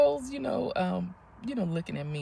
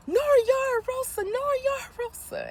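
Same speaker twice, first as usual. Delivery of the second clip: high, loud re-enacted call down the street; 6 dB louder than usual. Fan running after the girls down the street, re-enacted high calling out. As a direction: call their names after them, very high, starting loud then easing.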